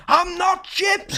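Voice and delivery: high, drawn-out mock scream; retold scream. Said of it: "He's, like, screaming out".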